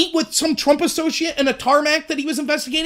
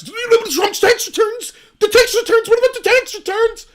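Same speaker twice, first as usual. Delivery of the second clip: mocking frantic whine; 10 semitones higher, 4 dB louder than usual. Commentator mocking frantic Democrats, high whiny panic. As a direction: ham up a hysterical critic.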